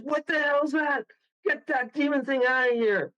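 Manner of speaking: high-pitched indignant retold yell; retold outraged outburst. Owner confronting the band over smashed glasses, retold outraged yell. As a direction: imitate an alarmed owner's outburst.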